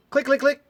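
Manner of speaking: high, loud clicking of a dead starter; imitated car clicking. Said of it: "I start the car like this".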